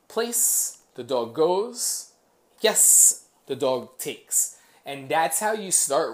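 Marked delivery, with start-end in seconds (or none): 0.1-0.8 high-pitched one-word dog command; trainer's high cue word. Shown as a demonstration. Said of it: "I will say". 2.6-3.3 loud, high "Yes" to the dog; trainer's emphatic reward cue; marker word. Shown as a demonstration. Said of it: "I will say".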